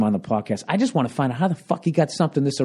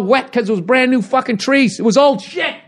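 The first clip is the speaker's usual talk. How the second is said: exasperated, raised-voice complaint; worked-up rant; 11 semitones higher than usual. Real, not acted. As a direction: spit it out at the peak of a rant. Mid-rant, then tells himself calm down.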